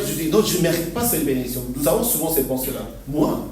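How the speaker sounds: normal talking voice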